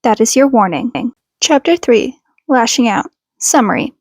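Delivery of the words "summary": usual speaking voice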